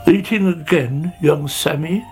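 mildly weary character voice; lightly sighed, disapproving question; sighing Snail. Acted. Snail chides greedy caterpillar, mild sighing reproach. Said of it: "he sighed".

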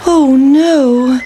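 high, loud wail of dismay; dismayed pancake. Acted. Pancake stopped at the river, dismayed. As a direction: stretch it out in alarm.

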